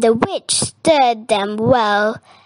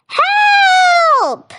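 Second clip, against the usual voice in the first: very high, loud, drawn-out shout; cry for help; 6 dB louder than usual. A narrator's reading. Witch fleeing the dragon, shouting high for help. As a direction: shriek it out as the hunted witch. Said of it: "cried the witch".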